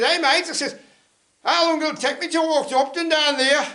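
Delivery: loud call across to a stranger. Retold or imitated. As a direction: hail him from a distance. Calling out to the yokel.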